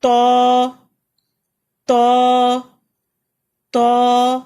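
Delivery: تو loud and heavy; read aloud. Shown as a demonstration. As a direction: call out تو at full voice. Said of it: "اونچی آواز سے پڑھیں".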